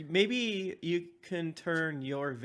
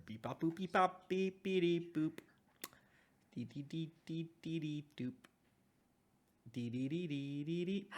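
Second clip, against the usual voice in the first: quiet beeping robot noises; 11 dB quieter than usual. Real, not acted. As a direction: hum computer sounds softly. Connection noises while waiting.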